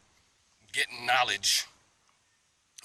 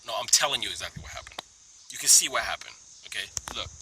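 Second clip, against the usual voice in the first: normal talking voice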